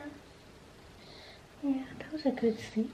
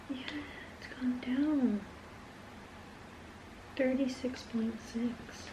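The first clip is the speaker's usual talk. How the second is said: whispering; 12 dB quieter than usual. Real, not acted. Whispering temperature reading.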